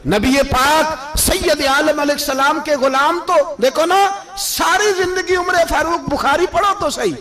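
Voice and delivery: high-pitched, ringing sermon delivery; impassioned preaching. Acted. Preacher praising the devotees' lifelong prayer for martyrdom, impassioned. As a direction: preach it high and insistent to the congregation.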